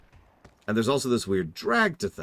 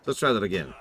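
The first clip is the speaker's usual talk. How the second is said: ordinary talk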